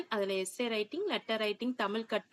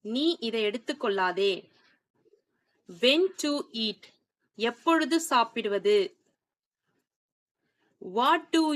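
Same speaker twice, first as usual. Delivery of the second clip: language-lesson read-out; much slower than usual. Shown as a demonstration. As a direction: read each model question slowly for learners to follow. Reads out model questions and translations. (பாடம் நடத்தும் நிதானமான, தெளிவான வாசிப்பு)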